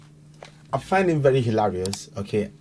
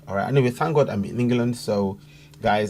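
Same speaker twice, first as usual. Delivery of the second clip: normal talking voice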